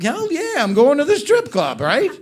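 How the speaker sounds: hammed-up eager buddy cheer; eager yes. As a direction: jump at the offer like a kid at Christmas. Eager reply to strip club offer.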